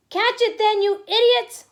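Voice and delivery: very high, loud scolding scream; furious scream. Acted. Grand High Witch at her witches, furious scream. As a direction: scream at your useless underlings. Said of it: "screamed the Grand High Witch".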